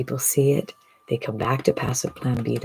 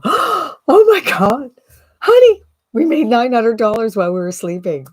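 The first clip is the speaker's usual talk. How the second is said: much higher and louder squealing disbelief; thrilled exclamation; 10 semitones higher, 8 dB louder than usual. Pretend. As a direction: wake your spouse with amazing news. Imagined couple waking to sales.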